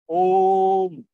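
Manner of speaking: ओम held very long and loud; लंबा खींचा हुआ ओम; long drawn om. Shown as a demonstration. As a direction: pull ओम out as far as it goes. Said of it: "जिसको काफी लंबा खींचा जाता है".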